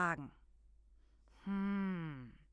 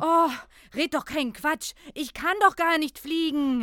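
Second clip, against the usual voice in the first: annoyed; 4 semitones higher, 8 dB louder than usual. Acted. Children quarrel loudly.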